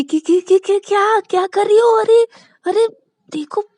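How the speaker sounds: high, loud stammering panic; terrified shriek. Acted. Shruti shrieks at Shreya to stop speeding, terrified. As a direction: shriek at the speeding driver in terror.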